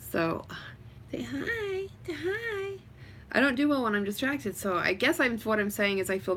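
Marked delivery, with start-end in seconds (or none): 1.1-2.7 high, soft coaxing singsong. Real, not acted. Coaxing a little one to say hi, baby talk. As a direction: coo it much higher and gently.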